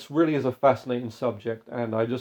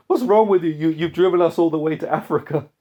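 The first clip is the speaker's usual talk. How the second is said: raised, higher voice, acting out shouting at the driver; imitated indignant shout; 5 semitones higher, 6 dB louder than usual. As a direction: retell a startled passenger's outburst, amused. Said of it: "he shouts at the driver".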